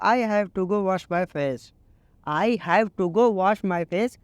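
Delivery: very slow, each English word laid out clearly; slow teaching read. Shown as a demonstration. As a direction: model the sentence slowly for learners. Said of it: "इसको English में बोलो".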